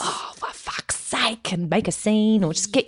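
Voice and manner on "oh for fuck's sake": slow, whispered exasperation; mock exasperated. Picking a fight with a stranger, mock exasperated whisper. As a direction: breathe it out like a fed-up customer. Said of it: "so you can go".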